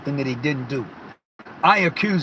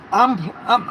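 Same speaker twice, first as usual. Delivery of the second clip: ordinary talk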